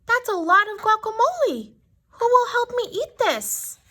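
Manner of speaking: high, excited hen voice; high bright hen call; clucking hen. A narrator's reading. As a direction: ask like an eager little hen. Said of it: "Little Red Hen clucked".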